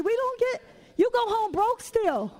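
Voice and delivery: ordinary talk, nothing marked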